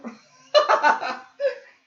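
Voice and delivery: high loud burst of laughter. Real, not acted. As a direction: crack up laughing. Laughing at her OCD remark.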